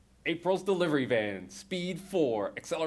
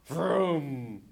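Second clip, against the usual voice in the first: engine vroom. Acted. Narrator voicing the van engine, vroom sound.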